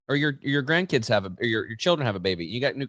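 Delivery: usual speaking voice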